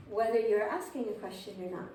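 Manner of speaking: usual speaking voice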